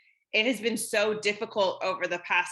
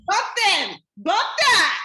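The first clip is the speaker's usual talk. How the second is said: higher and louder, brushing it off; defiant shout; 10 semitones higher, 8 dB louder, slower than usual. Retold or imitated. Friend loudly backing her friend's anger, defiant. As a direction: shout it off like you don't care.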